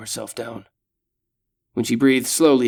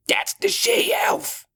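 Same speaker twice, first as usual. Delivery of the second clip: slow, breathy high orc rasp; sneering monster voice; raspy orc voice; much slower than usual. Acted. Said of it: "the orc says".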